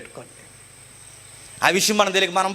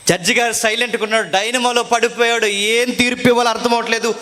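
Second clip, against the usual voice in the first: raised, impassioned preacher voice; fiery preaching; 7 semitones higher, 3 dB louder than usual. Acted. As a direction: plead Paul's case at full force. Preacher acting out Paul's trial. (ఉద్వేగభరిత ప్రసంగం)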